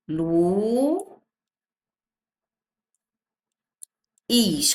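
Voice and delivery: "Lu" stretched and rising, long pause, then "is"; slow syllable-by-syllable "Lu... is"; separated syllables. Shown as a demonstration. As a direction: break "Lu, is" into two syllables for the class. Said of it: "quando a gente separa".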